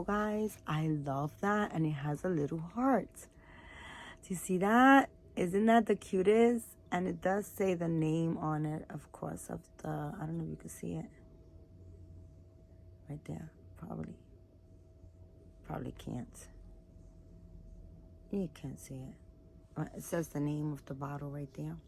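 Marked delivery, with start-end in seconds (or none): 3.2-6.7 slow, higher and louder gush. Real, not acted. Gushing over the little heart. 9.9-19.1 much softer breathy whisper. Real, not acted. Pointing at small printed name.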